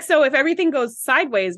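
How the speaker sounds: usual speaking voice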